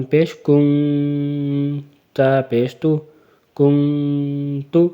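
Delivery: کن with the noon held long in the nose, no waw sound; slow nasal kun. Shown as a demonstration. Quran teacher showing the held noon in kun. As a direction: stretch کن into a long nasal hum. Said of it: "نون کی آواز ہی نکالنی ہے".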